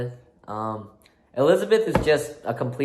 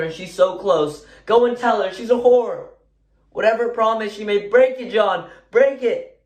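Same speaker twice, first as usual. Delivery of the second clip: pleading wife confronting her husband, high-pitched and building louder; reserved wife finally bursting out, pitch raised throughout; Elizabeth, bursting out; 7 semitones higher, 3 dB louder than usual. Acted. Performs Elizabeth's monologue from play.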